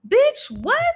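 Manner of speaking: high-pitched retold disbelief; mock disbelief. Viewer reacting to Gail, mock disbelief. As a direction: throw it out high, in mock shock.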